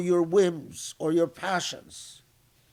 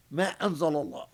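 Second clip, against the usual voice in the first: ordinary talk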